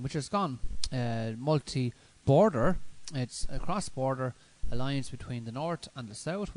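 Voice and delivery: normal talking voice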